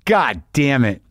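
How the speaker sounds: ordinary talk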